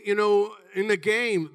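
usual speaking voice

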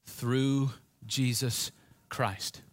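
spoken slowly as the key words; slow emphatic key words. Shown as a demonstration. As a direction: stretch out each word slowly. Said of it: "Here's our words".

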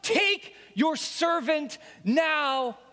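high, loud pleading cry, slowing at the end; begging shout. Acted. Despairing man shouting his plea up to God. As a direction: cry out to heaven in anguish. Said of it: "I begged".

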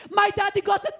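usual speaking voice

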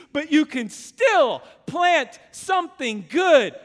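loud, slow preaching appeal; preaching at full force. Acted. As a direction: plead with the congregation, loudly. Preacher builds to a climax.

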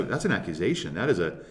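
ordinary delivery

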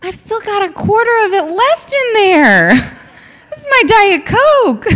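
high, laughing mock outrage over her soda; high laughing retold protest; laughing indignant protest. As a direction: protest through laughter, half offended. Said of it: "And I'm like".

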